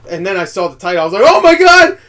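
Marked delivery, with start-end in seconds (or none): 1.2-2.0 very high, loud elated scream; happy outburst. Retold or imitated. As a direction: re-enact an overjoyed reaction at full volume. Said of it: "I got excited".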